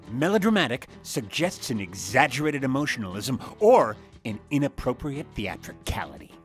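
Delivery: milk it like a stage tragedian; melodramatic. Shown as a demonstration. Voice reel, melodramatic reading of "melodramatic.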